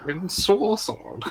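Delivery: mock-savoring Ensorcelled; drawn-out high mimic. Shown as a demonstration. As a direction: roll Ensorcelled out slowly up high. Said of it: "Say that again for me".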